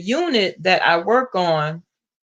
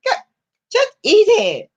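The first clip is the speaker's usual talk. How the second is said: put-on coworker's Filipino accent; 11 semitones higher, 4 dB louder than usual. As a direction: stretch it out in her Filipino accent, mocking. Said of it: "you have to be able to catch the accent".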